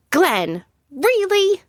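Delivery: name called, then high incredulous question; mild exasperated shout. A narrator's reading. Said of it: "and I shout".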